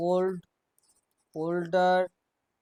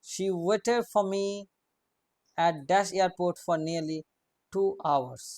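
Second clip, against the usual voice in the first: dictation; much slower than usual. Shown as a demonstration. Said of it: "dictating a fill-in question".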